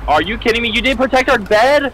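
loud high disbelieving rant; outraged. Real, not acted. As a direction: scold in disbelief. Scolding teammate over lost bed.

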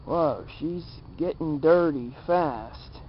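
normal talking voice

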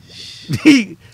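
sudden loud, high laugh; short laugh. Real, not acted. Speaker lands his jail point to the host, sudden loud laugh. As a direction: burst out in a brief, high laugh.

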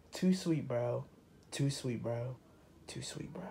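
repeated catchphrase fading out; fading to a whisper. Pretend. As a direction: let it fade away to a whisper.

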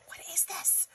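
gasped, hushed whisper of amazement; astonished whisper. Real, not acted. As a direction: gasp and whisper it in wonder. Gasps at surprise pom-pom.

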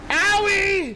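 sharp high yelp of pain. Real, not acted. Gamer getting hit, yelps loud and high. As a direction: yelp as something hits you.

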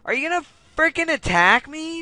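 high, loud exasperated demand; pissed off. Real, not acted. Gamer yelling at an opponent to attack, angry and impatient. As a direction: yell it in real irritation. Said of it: "I'm getting pissed off".

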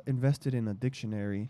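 speaking softly; low and soft, barely projected; soft-spoken. Real, not acted. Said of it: "Stop speaking softly".

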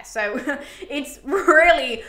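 uneasy laugh through the words; nervous laugh. Real, not acted. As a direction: laugh nervously, unsure how to say it. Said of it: "I'm laughing, but it's more like nervous laughter".

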